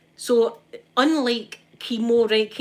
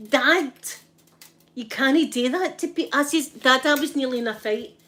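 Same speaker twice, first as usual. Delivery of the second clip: scolding dad; 5 semitones higher, 4 dB louder than usual. Retold or imitated.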